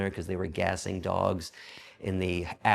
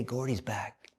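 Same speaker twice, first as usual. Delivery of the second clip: hushed, voiceless whisper; whispered rumor; 6 dB quieter, slower than usual. Retold or imitated. Prisoners whispering news of Gordy's return, whisper. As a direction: pass it on in a hush. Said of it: "the rumbling of the day".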